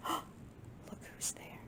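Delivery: slow secretive whisper. Acted. Reader whispers to the children before the reveal. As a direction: build suspense with a hush.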